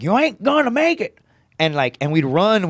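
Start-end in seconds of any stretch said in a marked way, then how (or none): 0.0-1.2 much higher coach imitation; high-pitched mock coach taunt; Coach Hamilton. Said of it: "he would be like".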